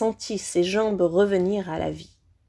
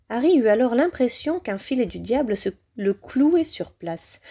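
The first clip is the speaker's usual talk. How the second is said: ordinary delivery, nothing marked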